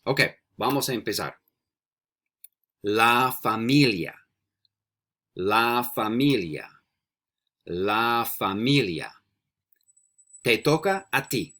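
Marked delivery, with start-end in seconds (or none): 2.8-4.1 "La familia" slowly and clearly, for repeating; model pronunciation. Shown as a demonstration. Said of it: "I'm gonna say the word three times". 5.4-6.7 "La familia" slowly and clearly, for repeating; model pronunciation. Shown as a demonstration. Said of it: "I'm gonna say the word three times". 7.7-9.1 "La familia" slowly and clearly, for repeating; model pronunciation. Shown as a demonstration. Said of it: "I'm gonna say the word three times".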